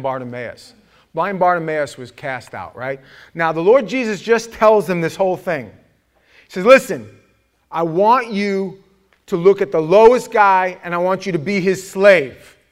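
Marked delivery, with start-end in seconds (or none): 3.3-12.6 loud, raised, emphatic sermon delivery; full-force preaching. Real, not acted. Preacher tells the congregation about Bartimaeus, forceful preaching. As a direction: drive each phrase home to the congregation.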